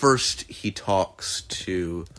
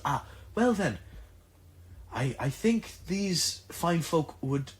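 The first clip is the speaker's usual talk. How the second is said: high, soft, polite questioning; Ajax's voice; 8 semitones higher, 7 dB quieter than usual. Acted. Ajax asking the seller how tall the place is, high and soft. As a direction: ask it high and a little shy.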